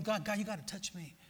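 quiet, intimate retold prayer; soft plea. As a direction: plead softly with God.

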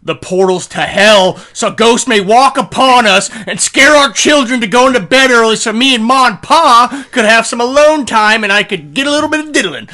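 loud, high mock scheming villager, rising to a shout; hammed-up Celt. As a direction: ham up a rowdy ancient Celt plotting to scare the kids. Acting out Celts scheming, shouted.

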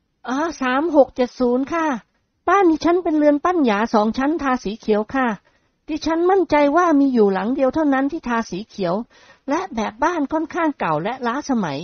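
put-on sweet polite young lady; young woman voice. Model describes her house. (เสียงหญิงสาวสุภาพอ่อนหวาน)

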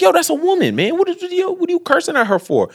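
high-pitched imitation of a young man objecting; mock indignation. Young boy defends a woman being cursed at, mock indignant.

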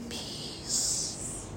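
breathy whisper of one word. Acted. Preacher answering his own question, in a whisper.

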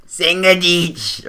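put-on hard German accent. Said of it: "do German and do it as, like, as, as hard as possible".

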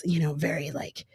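ordinary talk, nothing marked

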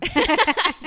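loud high laugh; burst of laughter. Real, not acted. Laughing as the baby wakes up.

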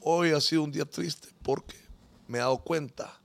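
low, soft, sorrowful retelling; imitating tearful man. Father confessing his children aren't his, mock tearful. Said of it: "con lágrimas en los ojos diciendo".

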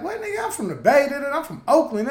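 high-pitched imitation of a defiant girl; mock indignant girl. Imitating a club girl defending herself, mock indignant. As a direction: mimic her protest, pitched well up.

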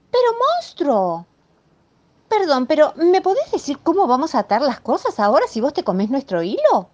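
a housewife demanding answers; exasperated Mrs Moe. Acted. Mrs Moe demanding how she'll paint now, exasperated high voice.

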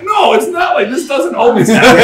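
high, urgent mock denial; hammed-up flustered denial; acted protest. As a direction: protest hard with the pitch jumping up. Retold flustered denial to the woman.

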